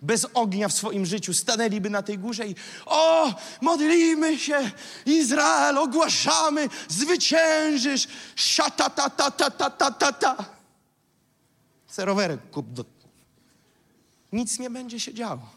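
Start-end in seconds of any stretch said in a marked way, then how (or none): 2.9-10.6 high, loud, drawn-out prayer shouting; hammed-up wailing intercession; mock fervent intercessor. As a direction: parody a frenzied prayer warrior, breaking into rattled syllables. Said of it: "że nieduchowi wstawiennicy bez ognia".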